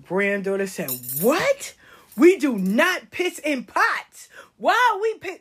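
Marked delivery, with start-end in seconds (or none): 1.2-5.4 high, emphatic shock, imitating a child; horrified child. Granddaughter appalled by grandmother's saying, horrified child. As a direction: mimic a scandalised little girl. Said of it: "She thought that was so horrible".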